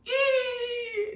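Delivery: piercing high squeal of glee; squealing yell to close the video; excited squeal. Real, not acted. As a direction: let out a shrill happy scream. Excited sign-off catchphrase yell.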